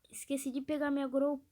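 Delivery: normal talking voice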